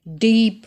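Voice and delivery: डीप with a long stretched ई, said firmly; long ie in Dieb. Shown as a demonstration. Teacher showing the long German ie. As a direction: draw out the ई in डीप. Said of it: "ई जो है वो long pronounce हुआ".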